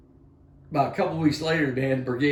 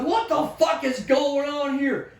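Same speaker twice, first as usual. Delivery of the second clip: high, loud, slow exclamation; retold bewildered shout; 12 semitones higher, 5 dB louder than usual. Sergeant major baffled by soldiers in fog, bewildered shout. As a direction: shout it in drawn-out disbelief. Retells bewildered reaction to the scene.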